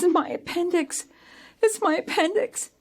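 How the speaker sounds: put-on high, pained kid's wail; kid faking agony. Kid at the foot of the stairs, mock agony. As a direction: play up the pain in a high voice. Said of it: "He says".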